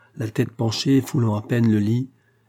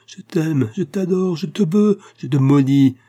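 high, impassioned outburst growing louder; desperate cry; 6 semitones higher, 6 dB louder than usual. A narrator's reading. Dying man at Pauline's locked door, crying out desperately. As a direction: plead and threaten at a raised pitch. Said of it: "criait le moribond en courant après elle".

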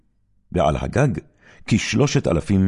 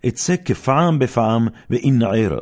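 higher, louder sure-of-himself declaration; confident boast; 6 semitones higher, 4 dB louder than usual. Acted. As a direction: proclaim it like nothing can stop you. Samson boasts as he wakes. (הכרזה בטוחה ורמה)

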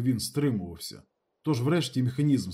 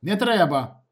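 high, raised warning; loud, urgent refusal; sharp warning; 6 semitones higher, 6 dB louder, slower than usual. A narrator's reading. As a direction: stop him with a sudden shout. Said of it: "раптом різко повернувся до нього Ніколаєч".

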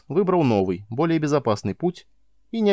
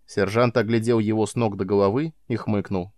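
ordinary delivery, nothing marked

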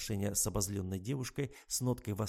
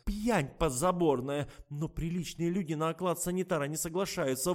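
higher, louder flustered wailing; doctor lamenting to the patient about the drunk orderly; 8 semitones higher, 6 dB louder than usual. Acted. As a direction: wring your hands aloud as you drag her along. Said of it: "причитая на ходу".

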